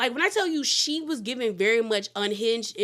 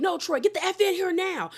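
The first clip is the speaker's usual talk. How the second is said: mock shout; 7 semitones higher than usual. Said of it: "she was like".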